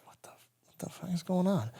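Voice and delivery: hushed disbelieving whisper; a dazed soldier under fire whispering; stunned whisper. Retold or imitated. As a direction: breathe it out in shock. Dazed under fire, inner reaction.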